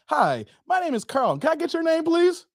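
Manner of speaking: lightly voiced doorstep canvasser; door-to-door preacher. Carl greeting a stranger at the door, mildly eager canvasser. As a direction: pitch it politely, lifting at the end. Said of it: "that's a J W line I gave you guys".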